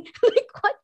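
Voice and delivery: high, loud laughing what; incredulous laugh; laughing disbelief. Real, not acted. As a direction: burst out in amused disbelief.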